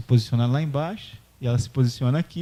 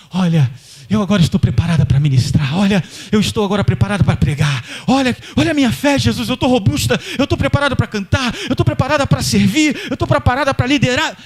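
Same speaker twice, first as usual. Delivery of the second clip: high, louder, fast proud bragging; a hammed-up boast of being ready for anything; boastful bragging; 8 semitones higher, 6 dB louder, faster than usual. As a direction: show off your faith to everyone. Woman boasts of her faith.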